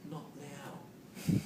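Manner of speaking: soft, calm "Not now." to the dog; gentle not now. Retold or imitated. Trainer gently telling her dog not now. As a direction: ease the dog off kindly. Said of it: "I just want to say".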